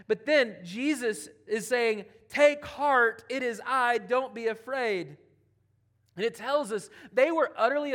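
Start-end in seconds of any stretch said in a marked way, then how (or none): 2.3-5.2 loud reassurance. Acted. Jesus reassuring, retold with force.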